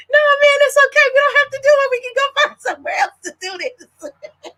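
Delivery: very high, breathless plea; high frightened backing out, laughing at the end; panicked pleading. Retold or imitated. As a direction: beg to leave in a high voice, half laughing. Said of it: "I'm like".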